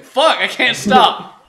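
loud slow laughing shout; laughing yell. Real, not acted. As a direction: laugh while sliding helplessly. Rolling downhill.

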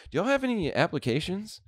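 high-pitched mock woman's voice; imitated customer. Crazy customer asking the clerk for work, high-pitched.

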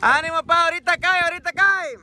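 high-pitched cheer; rally the team from the sideline; encouraging shout. Real, not acted. (grito de ánimo al equipo)

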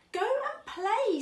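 lightly voiced dad sending a child to play; encouraging dad. A narrator's reading. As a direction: cheerily urge the little one on. Dad urging Otto in story.

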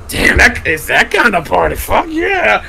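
high, loud mock party enthusiasm; excited imitation. Storyteller quoting Miguel keen on the party, excited. Retold eager partygoer's reaction.

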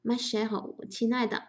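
ordinary talk

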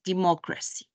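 ordinary delivery

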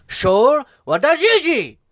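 loud, high, furious bark of "Sure, well, that Gigi"; angry shout. Shown as a demonstration. Friend shows how to say the insult angrily, shouting. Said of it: "you gotta do it with, like, a".

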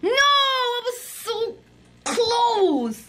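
high, slow, despairing no; slow wail. Real, not acted. Kid losing by a hair, slow high wail. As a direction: wail over the near miss. Narrowly loses.

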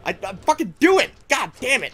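frantic shouting, high and loud; scared and angry. Real, not acted. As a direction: yell in frustrated panic. Panicked escape from zombies.

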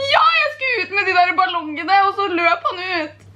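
tearful wail, dragging out at the end; put-on weepy voice that nearly turns real; crying act. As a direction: pretend to sob, getting lost in the act. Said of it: "jeg begynner å gråte på ordentlig".

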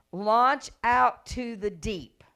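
slow, loud, drawn-out command. Retold or imitated. Jesus telling Simon to launch out, slow and loud. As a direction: stretch every word out like an order.

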